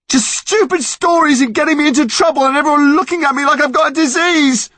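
high furious yelling; angry shout. Acted. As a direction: scream it at the monster, furious. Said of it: "pounding away".

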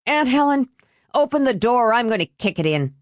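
high, fast, urgent threat; heated rapid warning; threatening. A narrator's reading. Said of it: "Norm threatened".

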